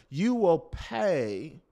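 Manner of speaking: ordinary delivery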